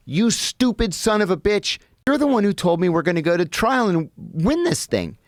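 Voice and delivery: mock outraged rebuke from an old attorney; gruff old man's insult. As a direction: snap at him like a furious old lawyer. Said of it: "mean old man".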